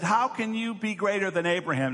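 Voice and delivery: indignant challenge. Acted. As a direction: question him as an offended crowd would. Upset crowd challenges Jesus.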